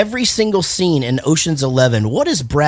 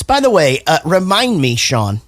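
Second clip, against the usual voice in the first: ordinary delivery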